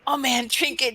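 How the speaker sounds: usual speaking voice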